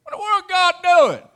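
loud, high preacher's cry; exclamation. Real, not acted. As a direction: cry out to the heavens in disbelief. Preacher exclaims at God's plan.

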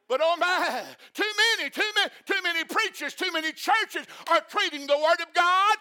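high, loud, driving preacher's cry; preacher rising to a passionate rebuke; fiery preaching. Acted. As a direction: hammer the warning home. Preaching at full force.

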